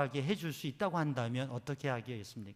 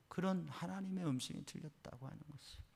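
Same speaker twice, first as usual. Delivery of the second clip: usual speaking voice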